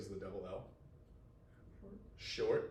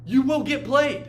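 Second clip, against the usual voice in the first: loud slow warning; 9 semitones higher, 10 dB louder than usual. Real, not acted. Teacher warns students they will get played, loud and slow.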